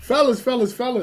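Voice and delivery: loud, high call to the guys. Acted. Calling out to co-hosts.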